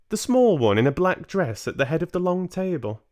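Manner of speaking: put-on high, loud, teasing old lady voice; a grandmother about to make mischief; sly grandmother. Grandmother baiting Mister Jenkins, high mischievous voice.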